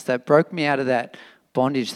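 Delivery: usual speaking voice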